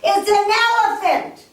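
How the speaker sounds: high, loud shout of a proud guess; elderly man shouting. Acted. Old man in back shouts his guess loud. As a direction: yell it out from the back of the room.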